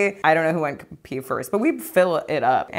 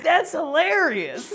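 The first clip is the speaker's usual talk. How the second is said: high, slow, drawn-out delight; imitating a guy cracking up; laughing prank victim; 10 semitones higher, slower than usual. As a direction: wail it as if helpless with laughter. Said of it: "He's like".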